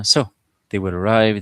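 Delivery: ordinary talk, nothing marked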